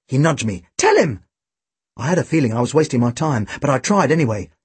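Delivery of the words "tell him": high-pitched urgent prompt; high boyish appeal; urging boy. A narrator's reading. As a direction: push your friend to speak up, pitch high. Tim urges friend to confirm.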